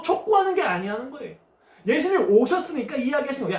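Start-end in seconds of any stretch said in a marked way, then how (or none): none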